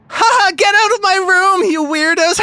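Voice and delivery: high, loud mocking cackle; possessed girl cackling. Possessed girl drives the party out, high loud cackle. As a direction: turn wild and spiteful, laugh them out. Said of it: "and says".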